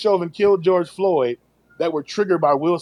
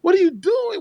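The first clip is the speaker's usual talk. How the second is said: "what are you doing" pitched much higher in disbelief; incredulous; 13 semitones higher than usual. Retold or imitated. Teller reacts to Nori blowing candle, "what are you doing" high. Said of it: "I was like".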